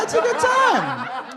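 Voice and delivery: high and very slow, hammed-up come-on; mock seductive. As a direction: stretch it out like a cheesy flirt.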